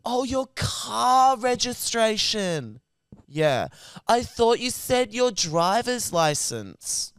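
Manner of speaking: lightly hammed-up call-centre guy; mock realising operator. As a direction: act out a dim dawning realisation. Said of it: "He goes".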